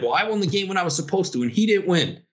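mocking whiny friend. Eliminated friend complains to commissioner, mocking whine. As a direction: mimic a sulking kid. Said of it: "He's like".

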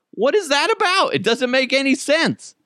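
baffled. Retold or imitated. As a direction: shriek in disbelief. Baffled, very high.

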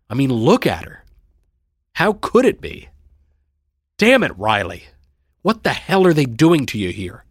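loud, rising dismay; upset protest, pitch leaping up; exasperated outburst. A narrator's reading. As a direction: blurt it out in helpless frustration. Brother blurts out his dismay.

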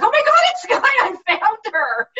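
high, loud squealing fan voice; imitating a thrilled fan. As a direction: shriek it like a fan spotting a star. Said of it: "they were like".

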